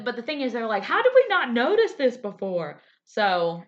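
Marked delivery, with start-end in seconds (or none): 0.8-2.8 surprised. Retold or imitated. Quoted fans, surprised outburst.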